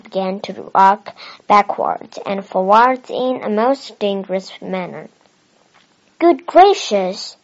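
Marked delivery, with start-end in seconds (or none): 6.2-7.4 higher, louder, drawn-out gasp of surprise; startled exclamation. A narrator's reading. Mrs Bird startled as the camera rocks, exclaiming. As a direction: Mrs Bird reacting in shock to the wobbling camera. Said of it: "exclaimed Missus Bird".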